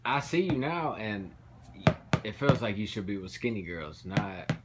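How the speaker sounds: normal talking voice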